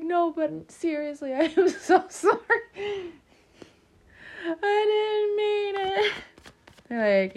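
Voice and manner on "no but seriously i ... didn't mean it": high, loud whine breaking into laughter; mock pleading. Aquarian begging fed-up friends, mock-tearful pleading. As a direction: plead in a hammed-up, tearful wail.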